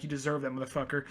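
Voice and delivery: normal talking voice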